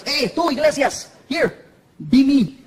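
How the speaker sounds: lightly voiced comic friend giving orders; slow, offhand summons in a borrowed voice; imitating Paul Rodriguez. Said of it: "He looks at me in the front seat, and he goes".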